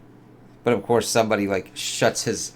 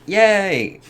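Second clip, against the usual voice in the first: much higher, louder happy squeal; gleeful cheer; 11 semitones higher, 6 dB louder than usual. Real, not acted. Viewer cheering the moon landing, high and loud. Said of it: "I'm like".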